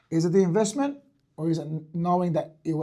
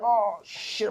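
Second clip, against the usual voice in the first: mock panic; 7 semitones higher, 5 dB louder than usual. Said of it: "I'd be like".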